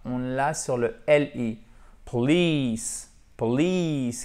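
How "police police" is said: "Police" with a long, relaxed ee in a stressed second syllable; "police" as po-LEEECE, falling on the long vowel. Shown as a demonstration. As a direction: draw out the ee of "police". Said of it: "qui a un I décontracté, long".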